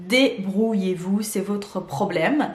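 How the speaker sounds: drawn-out syllables, softening at the end; slow, deliberate brush-off. Retold or imitated. Vlogger voices shop telling customers to manage, slow and deliberate. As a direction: spell it out slowly, syllable by syllable. Sums up site's notice as brush-off.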